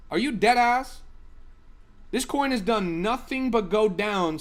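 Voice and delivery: can't believe what chat is showing him; exasperated rant. Real, not acted. Streamer berating chatter over an old coin, loud and incredulous. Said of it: "I'm getting worked up right now".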